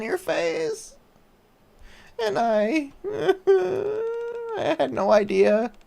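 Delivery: put-on high, slow, tearful girl's apology; tearful Crust. Crust apologizes to the commander, high and tearful. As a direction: voice the little chef choking up with guilt.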